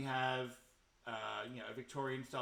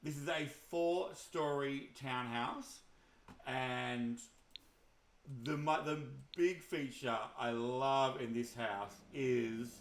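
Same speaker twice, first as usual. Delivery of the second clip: ordinary talk, nothing marked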